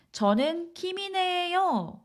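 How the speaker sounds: "김이네요" pitched high and stretched; stress on 김이네요. Shown as a demonstration. Introducing herself to many people, stress on "김이네요". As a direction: lean on "김이네요" after a light "저는". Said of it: "You're emphasizing 김이네 more".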